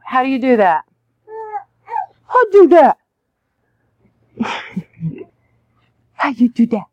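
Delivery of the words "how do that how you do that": put-on baby talk. Talking to babies, high playful.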